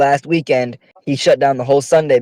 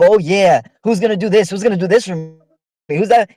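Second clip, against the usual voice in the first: higher-pitched mimicked questions; mocking imitation; 6 semitones higher than usual. Scolding housemates by parroting their questions, mocking. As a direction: mimic their helpless asking. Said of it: "have this bad fucking habit of".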